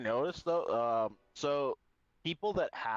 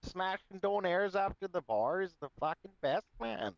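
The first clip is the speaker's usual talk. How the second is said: higher-pitched put-on Alberta accent; 6 semitones higher than usual. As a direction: ham up the Alberta hockey-bro talk. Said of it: "Had to bust that out".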